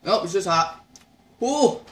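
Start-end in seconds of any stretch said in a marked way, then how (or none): 1.4-1.8 sharp high ooh from burning heat; pained ooh. Real, not acted. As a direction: yelp as the spice hits. Reaction to hot pepper.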